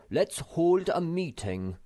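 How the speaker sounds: high, slow officious voice; pompous Snork. A narrator's reading. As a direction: chair the meeting grandly.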